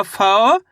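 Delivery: फाओ drawn out slowly with a rising glide; syllable sounding-out. Shown as a demonstration. Teacher showing learners how to sound out the syllable, फाओ stretched and rising. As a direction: stretch फाओ long, lifting the end. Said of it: "अब उच्चारण किस तरीके से करेंगे".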